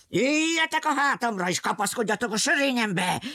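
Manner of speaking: lion calls out. Acted. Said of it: "kiáltotta".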